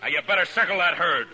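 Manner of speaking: loud, high-pitched insistence; forceful order. Acted. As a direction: call it out over a tense standoff. Marshal argues in armed standoff.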